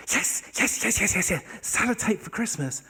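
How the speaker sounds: high, loud, breathless string of confirmations; excited child. Retold or imitated. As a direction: act an overjoyed little kid bursting out. Girl eagerly confirms her wish.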